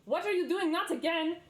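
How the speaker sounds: put-on high, loud squawking old gnome; frantic gnome shout. Old gnome yelling at his assistant. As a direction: yell it out as a fussy old gnomish man. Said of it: "He goes".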